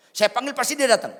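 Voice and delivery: high, loud, quick boast; imitated boastful wife. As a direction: play a bossy wife boasting. Acts wife boasting to pastor.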